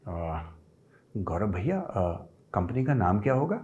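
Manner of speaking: soft tone. Retold or imitated. Senior finance man on the phone asking the company name, soft and gentle. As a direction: imitate a soft-spoken senior man, quietly. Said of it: "Atul Prakash जी थोड़े soft tone में बोलते थे".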